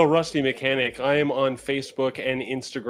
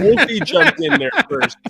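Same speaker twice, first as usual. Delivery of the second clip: laughing; 14 semitones higher, 9 dB louder than usual. Real, not acted. Laughing while reading names.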